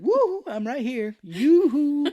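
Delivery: high, loud, drawn-out sing-song; hammed-up yoo-hoo; taunting call. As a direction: call out teasingly for attention. Plays pilot baiting Maverick to shoot.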